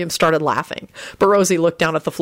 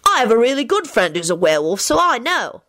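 put-on high, boastful boy; proud; 4 semitones higher than usual. A narrator's reading. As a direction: boast about a friend. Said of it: "he replied proudly".

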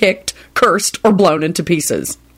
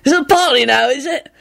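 higher, slurred and nosy; curious tipsy Victor; 10 semitones higher than usual. A narrator's reading. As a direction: lean in curious and a bit drunk. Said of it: "he said curiously".

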